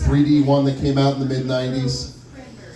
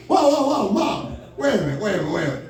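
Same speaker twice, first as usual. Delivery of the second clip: mock protest; 6 semitones higher, faster than usual. Protesting mom's assumption.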